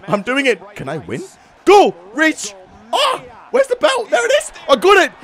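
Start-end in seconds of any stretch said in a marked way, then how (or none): 1.6-5.1 high, frantic exclamations; high-pitched, breathless-with-excitement gaming; urgent excitement. Real, not acted. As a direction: urge it on in short bursts. Straining for the belt.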